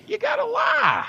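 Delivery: very high, slow plea; pleading disbelief. Real, not acted. Friend begging the host to say the skunk story is a lie. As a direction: beg him to take it back.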